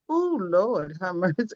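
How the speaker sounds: fighting real tears, voice dropping away; holding back tears. Real, not acted. Overcome while thanking the team, near tears. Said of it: "I'm trying not to cry".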